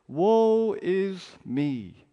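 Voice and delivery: loud, slow, drawn-out wail of despair; anguished cry. Acted. Isaiah wailing before God's holiness, loud and slow. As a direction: cry it out as a man undone.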